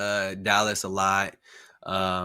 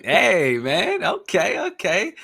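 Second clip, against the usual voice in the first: impressed excitement; 7 semitones higher, 4 dB louder, much slower than usual. Real, not acted. Guest works seven days a week.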